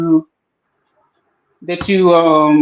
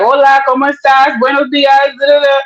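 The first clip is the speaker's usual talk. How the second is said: mock Spanish greeting; 6 semitones higher than usual. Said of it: "I gotta speak Spanish".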